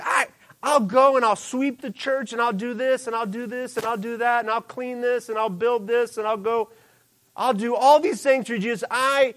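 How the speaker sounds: high, loud, gushing eager devotee; a boastful believer piling up promises; eager zealous follower. Retold or imitated. A zealous believer promising Jesus everything, high and gushing. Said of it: "We're, we're like that".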